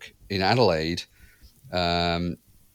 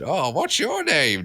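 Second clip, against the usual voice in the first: slow, higher spooky skeleton voice; 8 semitones higher, slower than usual. Retold or imitated. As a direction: drag it out like a talking skeleton. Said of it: "Do the skeleton voice".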